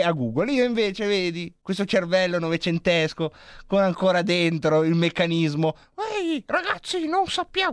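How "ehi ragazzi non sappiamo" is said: very high, squeaky little neuron; high cartoon neuron chatter; tiny neuron voice. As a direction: voice the neurons as small cartoon creatures. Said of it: "questi sono i neuroni che parlano".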